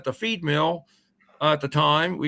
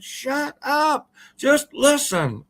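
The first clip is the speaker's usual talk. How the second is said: higher, slow urgent hush; tense hush; 10 semitones higher, much slower than usual. Retold or imitated. Older friend hushing the scared boy at the creek, tense urgent hush. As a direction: press him to keep still and listen.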